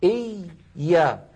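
"إي، يا" with the first ya held still; doubled ya split. Shown as a demonstration. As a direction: say "إي، يا" as two parts, lingering on the first. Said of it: "لاحظوا هكذا". («إي، يا» بياء ساكنة ثم متحركة)

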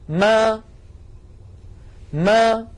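"ما" started from closed lips that then part; voweled mim demo; opening mim. Shown as a demonstration. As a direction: open the lips on "ما". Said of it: "يلصق شفتيه من غير صوت".

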